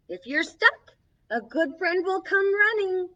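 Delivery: gentle picture-book reading; reading to children. Acted. Storybook host reading a friendship book to kids, gently. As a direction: read each page to the little ones.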